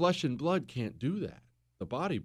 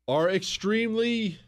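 ordinary talk

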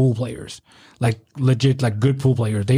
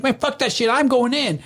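mock defiance; 10 semitones higher than usual. Guy arguing with the doorman, high mock defiance. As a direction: imitate a pushy guest, pitch way up. Acting out defiant guest at door.

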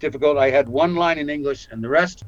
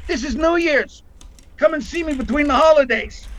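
flirty co-star; 13 semitones higher than usual. Retold or imitated. Lorraine's flirting line retold.

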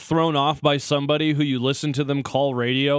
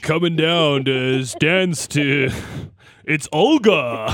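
very slow, drawn-out club announcer; big mock DJ reveal of the dancer; strip club DJ; 4 dB louder, much slower than usual. Co-host joins the silly names game, slow DJ voice. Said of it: "being a strip club D J voice guy".

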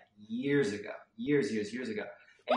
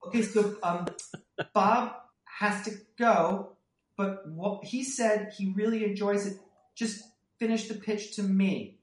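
horrified assistant; 11 semitones higher, 7 dB louder than usual. Retold or imitated. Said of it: "horrified assistant. And they go".

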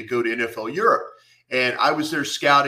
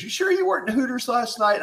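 lightly voiced young woman, much higher; high mock woman; 11 semitones higher than usual. Retelling the waitress's suspicious question, high mock voice. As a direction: pitch up into her doubting question. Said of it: "and she goes".

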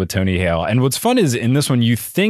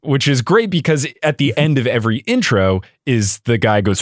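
usual speaking voice

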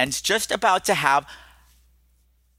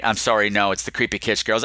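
ordinary talk

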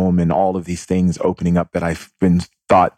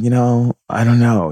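higher-pitched, unsure retold reply; retold protest; 5 semitones higher, 4 dB louder than usual. Telling Saul about what happened, higher-pitched protest. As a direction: act out not knowing what to think.

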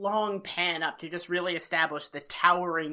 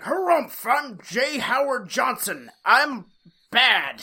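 loud blustering harrumph, much higher; pompous rich villain; 4 semitones higher, 7 dB louder, slower than usual. Retold or imitated. Describing the rich villain to his co-host, a loud pompous bluster. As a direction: puff up like a self-important tycoon. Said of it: "he's just like".

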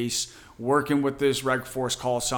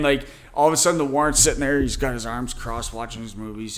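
ordinary delivery

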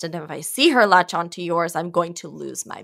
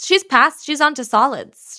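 firm, louder protest; indignant; 7 semitones higher, 6 dB louder than usual. Acted. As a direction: insist she's on to solids. Indignant mother objects.

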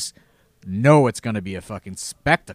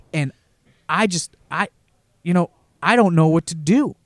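pleading Tracy imitation; 5 semitones higher, 5 dB louder than usual. Imitating near-tearful Tracy.

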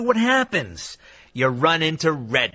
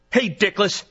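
irritated scolding; 8 semitones higher than usual. Acted. Scolding producer on air.

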